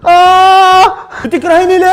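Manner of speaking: high, loud cry of pain; pained shout. Acted. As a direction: yell it out mid-contraction. Wife screams during labor. (صرخة ألم عالية)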